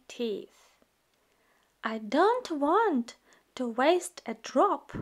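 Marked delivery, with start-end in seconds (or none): none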